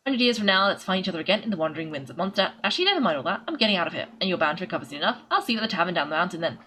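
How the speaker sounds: lively reading of a patient's farewell letter; letter read aloud. Acted. Streamer reads a patient's note to viewers, storyteller style. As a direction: read it out like the old miner writing it.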